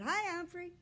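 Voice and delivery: put-on boy's greeting. A narrator's reading.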